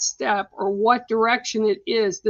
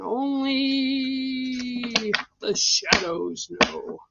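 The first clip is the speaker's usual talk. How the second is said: spooky mock narrator; 3 dB quieter, much slower than usual. Spooky aside about the investigation.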